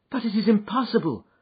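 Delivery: a hint of frightened disbelief; protesting young woman. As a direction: object in disbelief. Miss Hunter protests.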